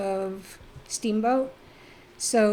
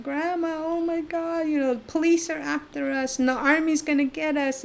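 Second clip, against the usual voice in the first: bawling; 6 semitones higher than usual. Retold or imitated. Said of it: "we start bawling".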